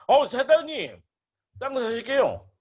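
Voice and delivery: high, loud sales greeting; pretend swindler. Land swindler greeting a prospective buyer, high eager pitch. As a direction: hail a client like a hustling agent. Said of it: "사기를 한번 쳐볼게요".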